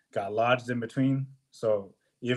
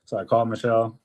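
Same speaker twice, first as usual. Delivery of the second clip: usual speaking voice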